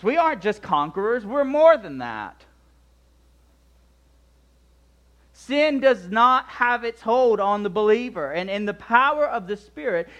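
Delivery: high, loud, driving sermon voice; forceful preaching. Real, not acted. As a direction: preach it boldly, pitch lifted, hitting key words.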